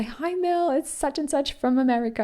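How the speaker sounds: high, chirpy comment voice; mock viewer greeting. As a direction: play a cheerful viewer. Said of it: "just say".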